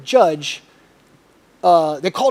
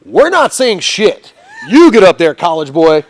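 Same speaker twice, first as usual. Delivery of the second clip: emphatic refusal; 7 dB louder, slower than usual. Retold or imitated. Friends sending college boy to speak, emphatic refusal. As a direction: push him forward with punched words. Imitates friends pushing him forward.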